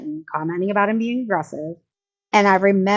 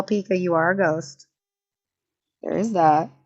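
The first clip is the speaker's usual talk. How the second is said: usual speaking voice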